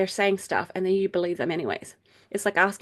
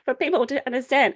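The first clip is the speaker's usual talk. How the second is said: exasperated plea; 7 semitones higher, 4 dB louder than usual. Retold or imitated. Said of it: "I'm like".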